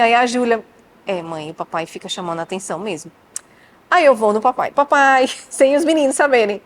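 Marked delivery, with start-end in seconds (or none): 1.1-3.1 lower, softer voice for the daughter; a hint of the daughter telling on dad, lower and softer; daughter's voice. Daughter Júlia quoted by mother. 4.7-5.3 calling out papai, louder; a mock call to get dad's attention, louder. Calling husband aside.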